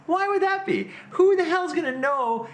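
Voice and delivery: high-pitched, incredulous outburst; exasperated self-scolding. Retold or imitated. Player mocking his own wrong guess, exasperated. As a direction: berate yourself in a high, exasperated voice.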